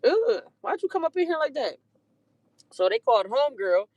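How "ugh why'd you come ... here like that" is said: disgusted high mock outrage. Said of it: "I'm like".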